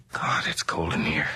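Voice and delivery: soft, unvoiced hush in a chilly room; uneasy whisper. Man in the cold sealed library whispering to himself, uneasy. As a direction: whisper it as if the room might hear.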